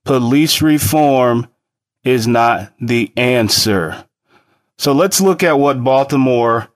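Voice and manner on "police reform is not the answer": slow, emphatic, word by word; over enunciating. Shown as a demonstration. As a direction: drag it out like a lesson. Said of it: "This is my one hundred and twenty-eighth time saying this".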